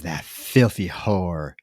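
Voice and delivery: low, soft, drawn-out contempt; slow bitter disgust. Retold or imitated. Dentist about the woman who wronged him, slow quiet contempt. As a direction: quote it slowly, savoring each word with disgust.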